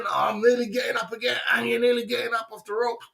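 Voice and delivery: high, shouting as he climbs off the ropes; fired-up shout. Retold or imitated. A knocked-down boxer urging himself up in a high shout.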